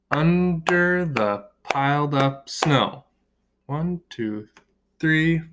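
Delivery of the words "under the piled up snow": Under the piled up snow, slowly, one beat per syllable; slow, beat-by-beat counting read. Shown as a demonstration. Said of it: "had to have seven syllables".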